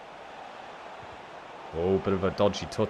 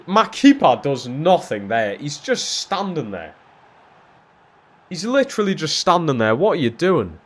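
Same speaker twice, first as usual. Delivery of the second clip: raised, loud incredulous complaint; exasperated rant; 7 semitones higher, 6 dB louder than usual. Real, not acted. Player blaming his motionless keeper, exasperated and loud. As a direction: berate a useless keeper.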